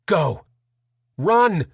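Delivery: frantic panicked order; frantic plea. Acted. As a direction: push them away in panic. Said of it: "pleas grew more frantic and panicked".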